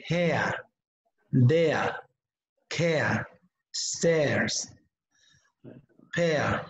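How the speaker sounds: "hair", "there", "care" ending in an open "ea" with no r; British pronunciation. Shown as a demonstration. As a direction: drop the r at the end of "care" and "pair", British style. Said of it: "In British English".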